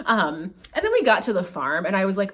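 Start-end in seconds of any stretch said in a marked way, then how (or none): none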